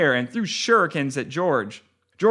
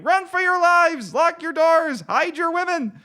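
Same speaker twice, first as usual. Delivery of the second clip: high panicked yelling; shouting; 11 semitones higher than usual. Acted. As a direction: shout a frantic warning.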